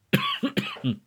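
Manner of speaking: sharp choking cough; sudden cough. Real, not acted. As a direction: cough as if something went down wrong. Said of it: "jetzt habe ich mich verschluckt". (Hustenanfall nach dem Verschlucken)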